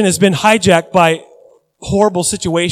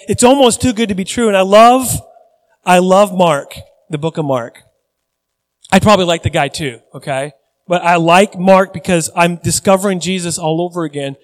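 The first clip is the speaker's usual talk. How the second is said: ordinary delivery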